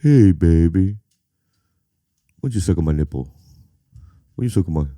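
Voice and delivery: low crooning seducer, half-joking; lazy deep croon for a laugh. Retold or imitated.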